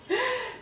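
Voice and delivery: laugh. Real, not acted. Laughs at own playful "vou.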